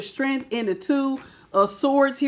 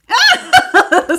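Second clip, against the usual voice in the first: sudden high, loud laugh; high burst of laughter; 12 semitones higher, 9 dB louder than usual. Real, not acted. Laughing out loud at a viewer's joke comment, high and loud. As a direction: crack up at the comment.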